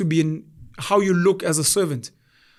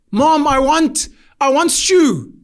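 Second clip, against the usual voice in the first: put-on higher, louder childish demand; whiny little sister; 7 semitones higher, 4 dB louder, much slower than usual. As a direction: whine for stew like a kid sister. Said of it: "my sister comes with English".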